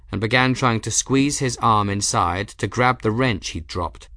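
ordinary delivery

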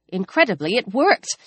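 usual speaking voice

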